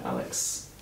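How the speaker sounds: usual speaking voice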